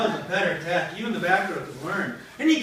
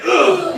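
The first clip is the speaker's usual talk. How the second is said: loud, high dying grunt; mock death grunt; 9 semitones higher, 13 dB louder than usual. Soldier killed by Macbeth, hammed-up death grunt. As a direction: grunt as if struck down.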